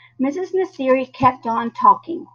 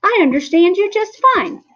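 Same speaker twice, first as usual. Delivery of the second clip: frantic squeak; 9 semitones higher, 3 dB louder than usual. A narrator's reading. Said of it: "I frantically squeaked".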